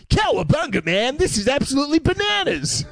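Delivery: mock turtle voice. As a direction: ham up a cartoon turtle. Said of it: "they're like".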